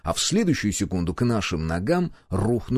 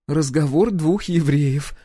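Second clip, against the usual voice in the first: slow, higher amused remark; wry snort; 6 semitones higher, 3 dB louder, slower than usual. A narrator's reading. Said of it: "хмыкнула Гюрза". (с усмешкой, протяжно)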